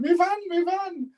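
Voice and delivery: high, shrill-pitched mock yell of the van owner; mock screaming. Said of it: "come yelling and screaming then".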